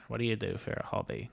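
hushed, mild imitation of a quiet character actor; quiet Tom Conti. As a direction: drop it right down like the soft-spoken actor. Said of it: "'Cause he talks quiet".

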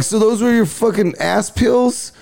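high, incredulous question; can't believe what he's hearing; grossed-out high-pitched disbelief; disgusted disbelief. Retold or imitated. Mocking Chong about suppositories.

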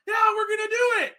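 high-pitched exaggerated yell; mock hyped shout. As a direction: imitate someone blurting out a sudden decision. Mocks the race director's sudden decision.

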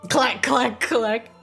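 vocal clack clack; clacking noise. Pretend. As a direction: mimic gems knocking together. Sound effect of gems clacking.

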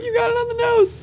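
very high, strained sobbing voice; tearful high squeak. Retold or imitated. Sobbing reply to the therapist.

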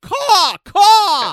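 high, loud bird cawing; great bird caw. Acted. Caw for the fans as the great bird. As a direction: squawk like a big bird. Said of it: "My favorite impression".

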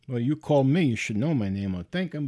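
usual speaking voice

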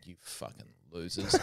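muttering a private insult in the waiting room; muttered insult. Retold or imitated. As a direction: mutter it to yourself at the other comedians. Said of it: "I was like".

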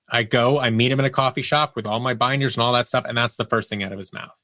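normal talking voice

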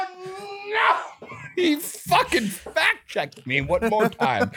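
high cry of no breaking into laughter; laughing outburst. Real, not acted. Host to cohost who fact-checked him, laughing outburst. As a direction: laugh it out in disbelief.

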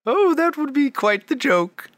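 high, bright-voiced lady shopkeeper. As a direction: gush over their outfits in a high lady voice. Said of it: "She says".

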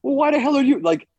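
a mock outburst at the booth clerk; retold angry yell. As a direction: re-enact snapping at the ticket guy. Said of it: "I yelled at the guy".

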